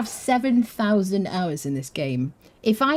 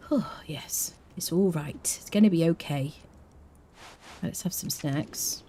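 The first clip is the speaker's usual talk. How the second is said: soft unvoiced self-soothing; hushed reassurance to herself; whispered comfort; 2 semitones lower, 5 dB quieter than usual. Real, not acted. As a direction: whisper to calm yourself down.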